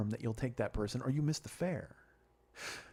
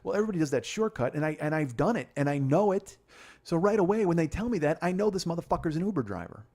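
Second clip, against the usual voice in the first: raised, higher voice, insistent; worked-up rant; 5 semitones higher, 6 dB louder than usual. Real, not acted. Explaining how they know he drives Uber, worked-up rant. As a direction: push it out loud with total conviction.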